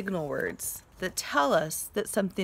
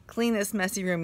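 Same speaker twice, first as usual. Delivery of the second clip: usual speaking voice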